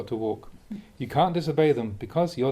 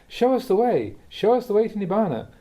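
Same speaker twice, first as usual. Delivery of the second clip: higher, louder imitating eager students begging; mock pleading; 7 semitones higher, 4 dB louder than usual. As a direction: beg for the easy way, half in jest. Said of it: "so we say".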